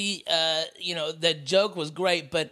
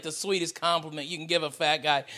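ordinary talk, nothing marked

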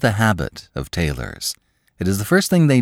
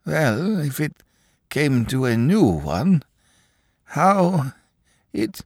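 stammering clerk; 10 semitones higher, slower than usual. Acted. Akaky stammering high.

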